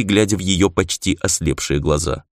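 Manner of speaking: ordinary delivery, nothing marked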